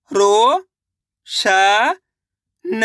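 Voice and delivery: अ, नो, खा drawn out separately, the first two rising; syllable by syllable. Shown as a demonstration. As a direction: sound out अ, नो, खा with long gaps. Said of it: "तो मिलके बन जाएगा". (अ, नो, खा अलग-अलग खींचकर)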